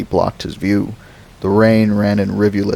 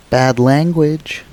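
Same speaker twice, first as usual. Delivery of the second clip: put-on child scolding; 7 semitones higher, slower than usual. Lex scolding Rodriguez.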